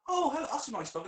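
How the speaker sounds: high, sing-song friendly hello; mock-cheerful greeting to a stranger; chirpy high greeting. Me greeting a dog owner, high and chirpy.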